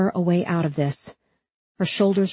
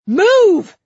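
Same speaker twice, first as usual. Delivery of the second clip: very high, very loud yell; screamed order; 11 dB louder than usual. A narrator's reading. Cop to Huck blocking him, screamed order. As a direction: scream the single word as a command. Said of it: "the cop screamed".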